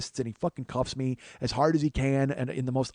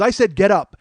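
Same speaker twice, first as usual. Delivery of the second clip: loud, raised-pitch cop order; retold insistent police command; 7 semitones higher, 9 dB louder than usual. Officer repeats order to man on the ground, loud command. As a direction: imitate an officer losing patience, loudly.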